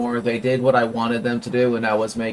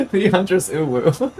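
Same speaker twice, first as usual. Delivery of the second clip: laughing; 6 semitones higher than usual. Pretend. Repeating the joke while laughing.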